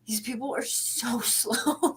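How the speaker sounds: slow, hushed mock complaint; quiet, drawn-out imitation with a laugh; imitating sister. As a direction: drag it out softly as a retold aside. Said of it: "she's like".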